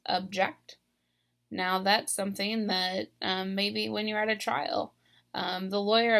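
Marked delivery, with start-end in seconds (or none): 0.1-0.8 "object" as the verb, ub-JECT; "object" stressed on the second syllable; last-syllable stress. Shown as a demonstration. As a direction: land hard on -JECT in "object". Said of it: "I'm stressing this last syllable".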